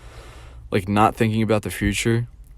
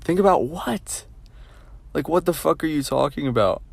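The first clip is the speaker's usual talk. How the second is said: incredulous; 5 semitones higher than usual. Real, not acted. Ranting about advice to plan ahead, incredulous.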